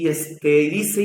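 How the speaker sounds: normal talking voice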